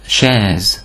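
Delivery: chaise starting with "sh", ending in Z. Shown as a demonstration. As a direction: say chaise as "shez". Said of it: "H betűcsoportot S-nek ejtjük".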